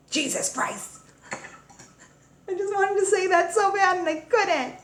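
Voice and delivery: high giggling release; giggly glee. Real, not acted. Grown daughter finally swearing freely, giggling high. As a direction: giggle through the forbidden words. Gleefully saying the forbidden words.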